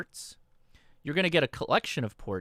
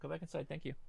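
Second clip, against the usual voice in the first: much softer, slightly higher aside; quiet aside; 4 semitones higher, 13 dB quieter, much faster than usual. Real, not acted. Aside to someone off the stream.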